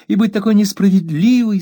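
high, lightly tearful reproach; mother near tears, high and pleading; tearful plea. A narrator's reading. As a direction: plead through mild tears. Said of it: "плача ещё горше".

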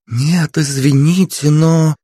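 a receptionist faltering mid-refusal; nervous receptionist. A narrator's reading. Receptionist refuses again, slowly, hesitantly. Narrator voices female receptionist.

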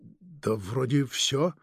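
slow, unsure, dragged-out answer; hesitant. A narrator's reading. Agent unsure he has told everything, hesitant. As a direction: answer as if not quite sure. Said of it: "нерешительно проговорил Сурков".

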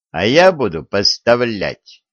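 ordinary delivery, nothing marked

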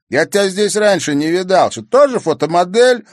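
loud, high-pitched needling; annoyed and scornful; irritated challenge. A narrator's reading. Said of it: "раздражённо поинтересовался Бельцев".

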